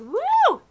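high, loud "woo"; triumphant whoop. Retold or imitated. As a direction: whoop like a workout just finished. Acting triumph after a workout.

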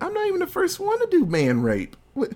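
high-pitched parody of an indignant Tarantino; mock Tarantino rant. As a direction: play him as a whiny, defensive director. Mocking Tarantino's answer to critics.

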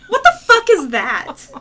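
high, loud disbelief; incredulous outburst. Real, not acted. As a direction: exclaim it high and loud, truly baffled. Incredulous reaction to absurd rule.

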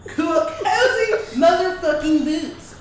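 slow, stretched show name call; drawn-out announcer. Acted. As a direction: draw the show name out slowly.

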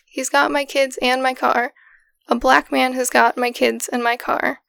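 ordinary talk, nothing marked

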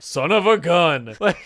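slow, drawn-out grumble of a beaten ref; mock stunned ref. Retelling the ref giving in to the coach, slow and put out. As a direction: let it sink in, slowly and put out. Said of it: "the ref's like".

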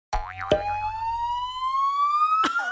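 put-on high delighted kid laugh; excited laugh. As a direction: laugh with surprise and joy. Boy laughing at card.

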